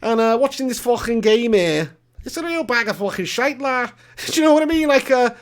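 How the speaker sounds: high-pitched, hammed-up Scouse; Scouse accent. Streamer playing a Scouser moaning about the game, in high-pitched Scouse. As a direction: put on a thick Scouse caricature, pitched well up. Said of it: "Pig, do a Scouser impression".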